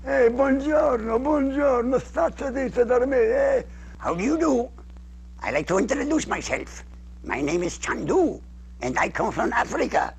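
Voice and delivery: high puppet voice through still lips; dummy voice. Shown as a demonstration. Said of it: "dummy voice".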